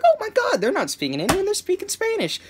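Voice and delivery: high-pitched mock amazement; astonished reaction. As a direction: act out an amazed realisation, voice jumping up high. Retelling own astonished reaction.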